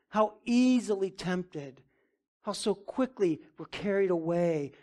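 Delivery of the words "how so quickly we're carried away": ordinary delivery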